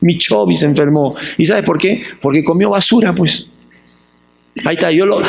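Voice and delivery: ordinary talk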